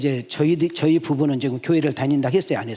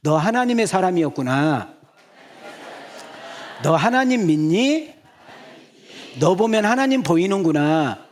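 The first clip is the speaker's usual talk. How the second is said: louder, higher marvelling at a neighbour; demonstrated exclamation; 5 semitones higher, 3 dB louder than usual. Shown as a demonstration. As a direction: show the congregation how to say it with wonder. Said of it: "손을 이렇게 들으시고 옆 사람 보고".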